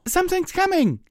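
very high, loud warning yell; high-pitched yell. Acted. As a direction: shriek out the alarm. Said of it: "the high-pitched yell".

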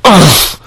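startled loud oh; sharp gasp. Acted. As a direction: let an oh burst out high and loud. Sudden exclaim in roleplay.

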